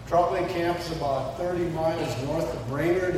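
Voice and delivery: ordinary talk, nothing marked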